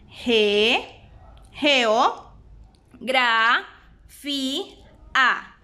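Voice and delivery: "Ge, geo, gra, fi, a" split with pauses; syllable by syllable. Shown as a demonstration. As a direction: spell "geografía" out in spaced syllables. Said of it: "La separamos de la siguiente manera". (separando sílabas)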